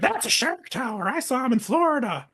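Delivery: put-on high little girl voice, tattling. Little girl tattling to the investors, high kid voice. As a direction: pitch way up like a kid telling on him.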